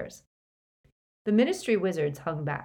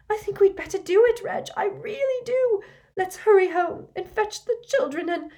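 much higher, louder, rushed woman's voice; frantic wife; 14 semitones higher, 6 dB louder than usual. A narrator's reading. Mrs Cattermole urging her husband to flee, high and frantic. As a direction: pour it out breathless with urgency.

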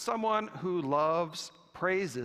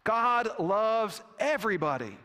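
firm, higher-pitched declaration; 5 semitones higher, 3 dB louder than usual. Retold or imitated. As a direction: state it firmly, with weight on each word. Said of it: "the bishop said firmly".

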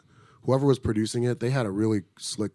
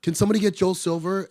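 much higher, urgent imitation; mock panicked plea; 10 semitones higher, 4 dB louder than usual. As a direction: play her frantic request, up high. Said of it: "she started freaking out".

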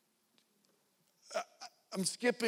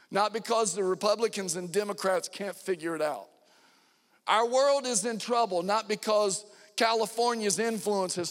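full-force preaching; 3 semitones higher than usual. Acted.

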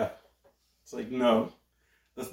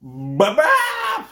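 high, loud "ba-bap" reveal; mock fanfare; 14 semitones higher, 7 dB louder than usual. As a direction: belt out a little fanfare. Fanfare revealing the next figure.

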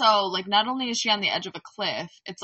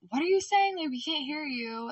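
calling out; 7 semitones higher than usual. Retold or imitated. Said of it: "Trent's responding".

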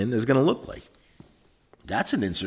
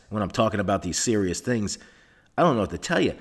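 usual speaking voice

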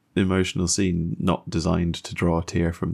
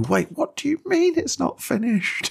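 much higher, startled disbelief; mock surprise. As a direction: play a viewer caught off guard. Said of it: "Here, our fellowship at last".

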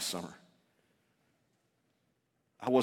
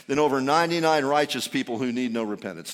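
ordinary talk, nothing marked

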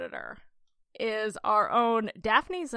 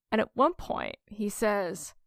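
ordinary delivery

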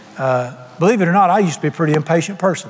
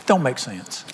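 ordinary delivery

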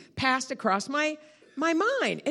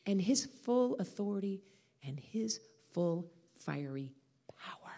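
hushed reverence; 4 semitones lower, 8 dB quieter than usual. Real, not acted. Preacher names God's power to the congregation, hushed reverence. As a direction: drop softer with each weighted word.

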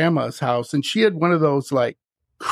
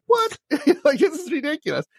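high incredulous "What?"; surprised; 10 semitones higher, faster than usual. Retold or imitated. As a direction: squeak it out in disbelief. Incredulous reaction to haunted claim.

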